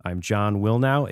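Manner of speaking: ordinary talk, nothing marked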